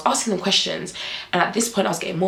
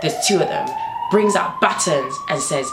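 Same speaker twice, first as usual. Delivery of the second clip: ordinary talk, nothing marked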